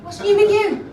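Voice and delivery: high, loud question. Pretend. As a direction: raise the voice for someone who missed it. Repeats louder after "Sorry?.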